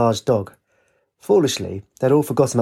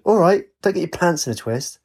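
raised-pitch, mildly heated retort; anxious, enraged shout; 6 semitones higher than usual. A narrator's reading. Katie answers Wraith's scolding, mildly enraged shout. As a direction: snap back with understated anger. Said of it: "Katie, anxious and enraged, shouted".

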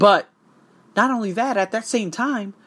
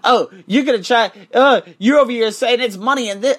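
mocking troll voice; 8 semitones higher, 7 dB louder than usual.

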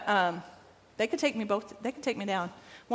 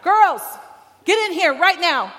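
loud, higher pretend scolding; mock mad call; 6 semitones higher, 7 dB louder than usual. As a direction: call them in as if they're in trouble. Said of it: "I act like I'm mad".

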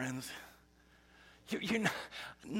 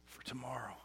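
whispered, much softer; hushed; 9 dB quieter than usual. Real, not acted. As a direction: let it fade to a whisper. Sermon, whispered echo.

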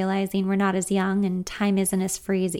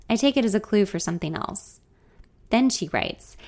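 usual speaking voice

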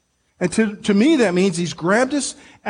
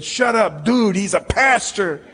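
mock scolding translation; 4 semitones higher than usual. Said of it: "it sounded like he said".

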